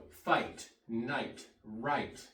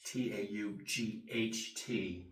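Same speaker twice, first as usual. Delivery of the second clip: ordinary talk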